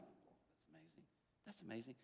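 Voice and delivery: hushed, breathless wonder; whispered amazement. Retold or imitated. Reading about God's love alone, whispering in awe. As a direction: whisper it like it just hit you. Inner reaction while reading.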